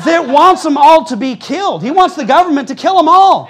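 high, loud, indignant retelling; imitated outraged rant. Disgusted man telling the repairman about a pastor, imitated outraged rant. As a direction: raise the voice into a mock outraged shout.

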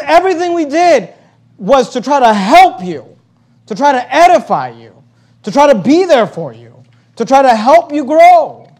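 loud, punched phrases with pauses between; impassioned preaching. Acted. Apostle pleading his efforts to church, forceful and loud. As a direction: drive each phrase home at preaching force.

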